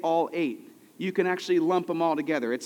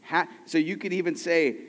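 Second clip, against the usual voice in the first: ordinary talk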